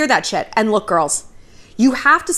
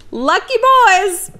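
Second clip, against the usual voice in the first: higher, slow sarcastic sing-song; high mocking drawl; 9 semitones higher, 4 dB louder, slower than usual. Real, not acted. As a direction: tease it, stretched out high. Ironic aside.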